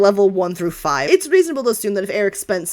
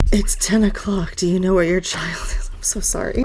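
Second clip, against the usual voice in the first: whispered mock announcement. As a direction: whisper it like a spooky ad. GM jokes mock PSA.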